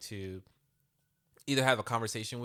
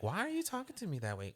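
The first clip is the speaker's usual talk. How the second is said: quick, high, exasperated question; a parent snapping back at a mouthy kid, fast and high; annoyed parent; 7 semitones higher, faster than usual. Retold or imitated. Parent scolding son over his attitude, quick and high. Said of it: "gets an attitude with me".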